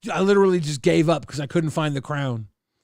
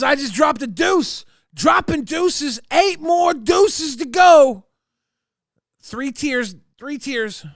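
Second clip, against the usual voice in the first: much higher, louder and slower cheer; excited; 8 semitones higher, 4 dB louder, slower than usual. Real, not acted. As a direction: hype the donations up. Excited about donations.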